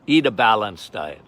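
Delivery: low, scoffing doctor voice; mimicking the physician. Physician dismissing sugar question, low scoff. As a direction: drop low and wave it away. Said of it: "Well, I said".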